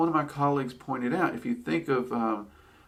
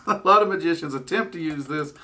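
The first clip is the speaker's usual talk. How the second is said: amused laugh; 5 semitones higher, 3 dB louder than usual. Real, not acted. Amused at magicians' misuse.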